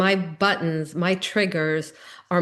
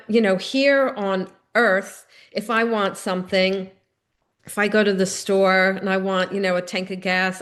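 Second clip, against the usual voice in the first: normal talking voice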